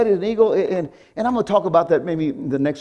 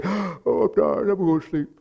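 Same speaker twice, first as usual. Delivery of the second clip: sleepy whine; 3 semitones higher, 4 dB quieter than usual. Retold or imitated. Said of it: "And I say".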